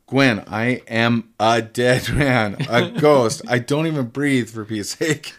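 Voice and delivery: loud, emphatic retold protest, each word weighted at first; exasperated insistence. Ghost tells Gwen why marriage is impossible, exasperated insistence. Retold lovers' quarrel, he refuses her.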